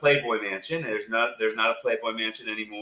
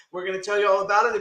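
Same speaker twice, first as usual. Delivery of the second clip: normal talking voice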